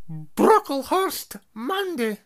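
high, loud stern roll call; calling a name. A narrator's reading. McGonagall calls the next student to the stool. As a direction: call the name out across the hall.

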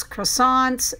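ordinary delivery

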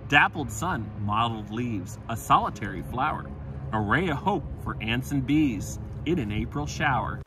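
light, lilting nature-verse reading; poem recital. Acted. Narrator reciting a spring wildflower verse, lilting. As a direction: savor each rhymed image.

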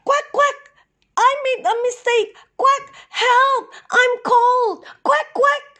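a stranded little duck begging for help; stuck cold duck. A narrator's reading. The frozen duck pleads with the flock for help, high and pleading. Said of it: "called the duck".

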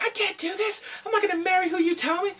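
very high-pitched whiny imitation; hammed-up high girl voice; mock protest. Retold protest by Mary.

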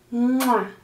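smacked "mwah" kiss; kiss sound. Acted. Grandma kisses Dani goodnight, kiss sound. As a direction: make a big voiced kiss noise. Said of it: "le da un besito".

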